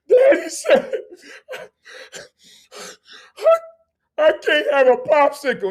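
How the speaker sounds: hammed-up sobbing child; crying toddler. Toddler complaining to the visitor about no popsicle, mock blubbering.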